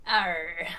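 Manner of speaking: a short pirate "arr"; pirate growl. Pretend. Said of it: "Read the topic ideas sentence in your pirate accent".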